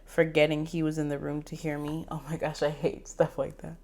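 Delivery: usual speaking voice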